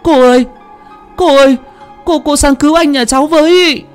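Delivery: high, loud urgent calls; calling out loud. A narrator's reading. Sister calling the aunt for help, loud and high. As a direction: shout for help across the yard. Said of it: "con Duyên gọi to".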